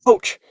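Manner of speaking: very high, quick yelp; pained yelp. A narrator's reading. Boy with a bonked foot, yelping. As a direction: squeal out in sudden pain. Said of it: "he yelped".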